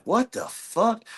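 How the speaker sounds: high, soft stunned disbelief; shocked. Retold or imitated. Seeing the monitor pads on her, stunned and disbelieving. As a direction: gasp it out in shock.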